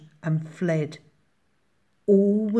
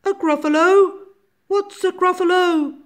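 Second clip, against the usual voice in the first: very high hooting owl voice; puzzled owl; 14 semitones higher, 8 dB louder than usual. A narrator's reading. Owl questions mouse in story.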